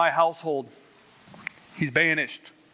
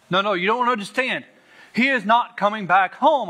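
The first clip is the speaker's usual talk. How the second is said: higher, louder urgent insistence; retold insistent mother; 6 semitones higher, 4 dB louder than usual. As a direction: imitate a worried mother insisting. Mother insisting dad is gone.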